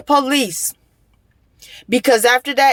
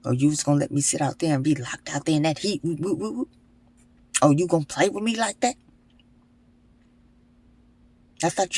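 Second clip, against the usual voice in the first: sarcastic scolding; 6 semitones lower, 7 dB quieter than usual. Retold or imitated. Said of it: "I'm all type of words".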